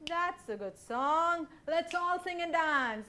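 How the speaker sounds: loud, slow, hearty proclamation; booming chief. Acted. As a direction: boom it out joyfully. Chief joins the fun.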